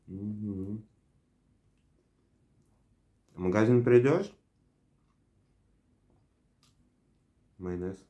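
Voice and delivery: talking while chewing food; muffled by a mouthful; mouth full, chewing. Real, not acted. Seen 2: Bites sandwich at -1, chews with full mouth through ~10s.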